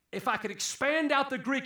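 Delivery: ordinary talk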